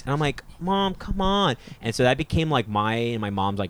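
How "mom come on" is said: higher-pitched mock whine; imitated childish protest; pleading kid. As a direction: beg like a child to mom. Pleading with mom in retelling.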